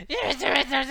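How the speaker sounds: high cackling witch voice. Retold or imitated. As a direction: point and croak like a witch. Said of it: "started talking like a witch".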